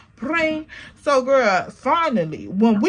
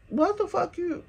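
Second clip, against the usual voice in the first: high incredulous quote; 6 semitones higher, 6 dB quieter than usual. Retold or imitated. Friend's incredulous look voiced.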